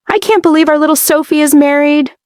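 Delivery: a hint of a proud aunt cooing; gushing guest. A narrator's reading. Family friend congratulating the bride, lightly gushing.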